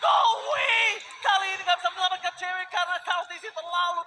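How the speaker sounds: hyped esports play-by-play shout; fighting game announcer. Acted. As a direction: yell the big steal call. Live esports casting climax.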